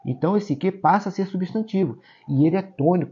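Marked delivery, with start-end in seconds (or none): none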